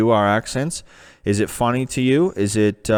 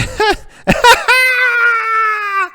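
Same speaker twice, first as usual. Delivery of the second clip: loud burst of laughter; 4 dB louder than usual. Real, not acted. Cracks up at search result.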